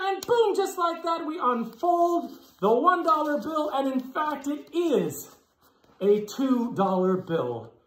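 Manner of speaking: put-on kids party magician. Magician performing the trick.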